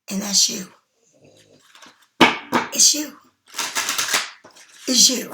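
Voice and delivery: hushed whisper, no voice. Real, not acted. Telling the viewer the rose is them, whispered. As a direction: breathe it to them like a secret.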